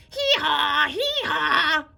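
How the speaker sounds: loud high donkey bray; bawling bray. Acted. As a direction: bray out in distress. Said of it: "bawled the donkey".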